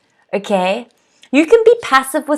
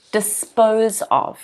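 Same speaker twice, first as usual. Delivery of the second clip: slow model; 4 dB quieter, slower than usual. Shown as a demonstration. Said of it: "the phrasal verb here is dispose of".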